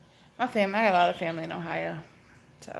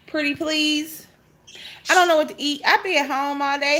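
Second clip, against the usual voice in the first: normal talking voice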